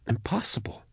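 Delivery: near-voiceless whisper; whispered disbelief. A narrator's reading. Max struggles with what she saw, whispering. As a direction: breathe the word out in disbelief.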